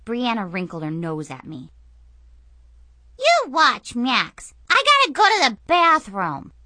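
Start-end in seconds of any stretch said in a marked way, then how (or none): 3.2-6.5 put-on whiny little girl voice. Sister whines back.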